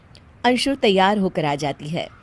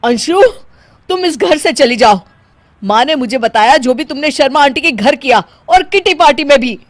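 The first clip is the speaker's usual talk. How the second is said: angry ordering out; 5 semitones higher, 6 dB louder than usual. Acted. Husband orders wife out.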